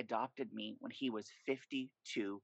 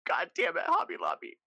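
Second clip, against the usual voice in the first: mock outrage; 7 semitones higher, 6 dB louder than usual. Real, not acted. Mock cursing Hobby Lobby gratefully.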